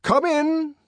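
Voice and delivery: high, loud command. Acted. Bernard calling the reason into the room, commanding. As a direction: order them through the door. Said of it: "he commanded".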